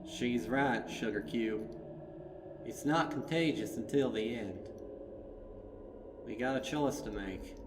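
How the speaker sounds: lightly voiced country farmer; Applejack. Applejack backing Twilight, lightly voiced, steady.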